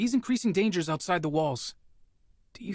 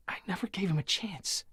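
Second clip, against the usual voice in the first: whispered, much softer confession; guilty whisper; 2 semitones higher, 5 dB quieter than usual. Acted. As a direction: breathe the admission out in shame. Guilty confession to his brother.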